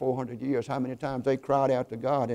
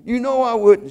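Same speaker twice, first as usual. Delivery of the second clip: tearful insistence; 8 semitones higher, 8 dB louder than usual. A narrator's reading. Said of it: "wiping tears away from her eyes".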